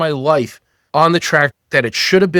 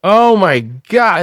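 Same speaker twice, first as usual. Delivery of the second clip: raised-voice replay of stunned questioning; mock bewilderment; 6 semitones higher, 4 dB louder than usual. Man replays his shock after divorce, bewildered. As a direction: act out disbelief, pitched high. Acts out past bewildered thoughts.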